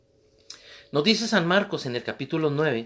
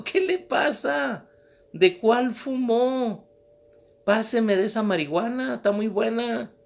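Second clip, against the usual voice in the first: high, slow, mocking voice of a rude young biker; mocking young man; 8 semitones higher, 4 dB louder than usual. Preacher voicing the rude young man, high and mocking. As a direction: scoff at the old woman like a stoned punk.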